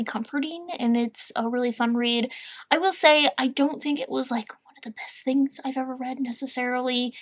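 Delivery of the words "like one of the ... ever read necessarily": whispering. Real, not acted. Quiet admission of criticism.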